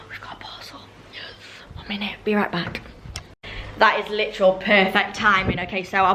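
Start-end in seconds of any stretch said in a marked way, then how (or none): 0.1-1.2 surprised whisper. Real, not acted. Surprised by a parcel arriving. 1.8-2.8 whispering. Real, not acted. Hurrying to the door.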